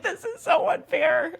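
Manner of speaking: very high, slow mock whine; dramatic brain. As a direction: play a self-pitying, hammed-up complainer. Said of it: "dramatic ass brain".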